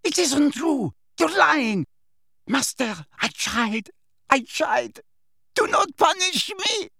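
very high, pleading witch voice; panicked screaming. A narrator's reading. Servant begging her master for mercy, panicked. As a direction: plead in a high, terrified shriek. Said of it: "she screamed".

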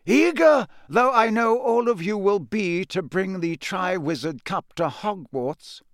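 high-pitched, measured oratory; Dumbledore, kindly headmaster. Acted. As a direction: address a whole hall as the old headmaster.

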